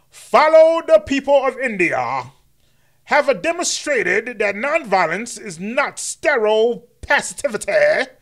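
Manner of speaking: grand mock King address; MLK impression. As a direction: stretch it out like a march speech.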